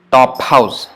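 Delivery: "Top house" with no vowel between p and h; correct top house. Shown as a demonstration. Teacher contrasting the mistake with the right form. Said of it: "Not topa house".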